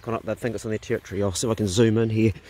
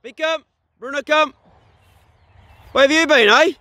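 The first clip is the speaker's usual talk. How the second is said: calling dogs; 13 semitones higher, 7 dB louder than usual. Real, not acted.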